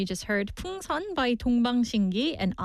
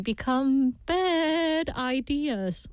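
slow punchline; 2 semitones higher, much slower than usual. Acted. Dad joke punchline read.

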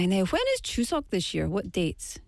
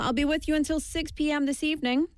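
ordinary delivery

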